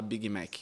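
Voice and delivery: "Big Mac" as "bigi mék"; the Brazilian "bigi mék"; Portuguese-style "Big Mac"; Brazilian pronunciation. Shown as a demonstration. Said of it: "vai preferir falar".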